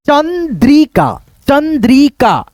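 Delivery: Chandrika slowly as chan, dree, kaa; teacher sounding out Chandrika, long vowel on the middle syllable; syllable-by-syllable model. Shown as a demonstration. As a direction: stretch Chandrika into three separate beats. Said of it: "ऐसे करके".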